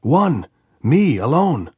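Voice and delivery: high, loud answer across the wire; shouting. A narrator's reading. Andres answering the sentry's challenge, shouting. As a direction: yell it up so they hear.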